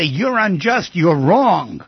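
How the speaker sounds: half-shouted imitation of someone's tirade; mock screaming accusation. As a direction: hurl accusations in a mild mock yell. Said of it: "if people scream at you and say".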